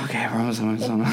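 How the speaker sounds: soft self-coaxing between breaths; acted-out attempt to calm down; breathing through nausea. Sick driver talking himself home, breathing through it. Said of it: "Like that".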